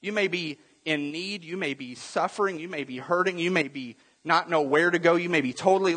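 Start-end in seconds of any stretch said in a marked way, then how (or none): none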